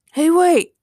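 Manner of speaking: calling out. A narrator's reading. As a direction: call out to halt a friend. Ray calls out to stop Doug.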